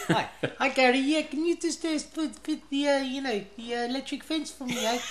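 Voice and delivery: put-on high, quiet old-lady voice; dotty old dear. Old customer asking Gary for a fence, high dotty voice. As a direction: imitate a dotty old woman, high and halting. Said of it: "this old dear. She was completely mad".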